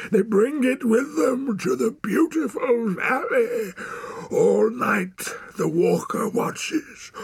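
high, loud, ranting wild man; roaring rant. A narrator's reading. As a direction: rant in a long roar, easing slightly towards the end. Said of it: "roared the man".

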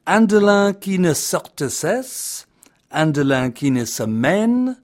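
hammed-up French accent, recited high and grand; horrible French accent. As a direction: declaim it like a proud Frenchman reciting poetry. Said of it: "my horrible French accent".

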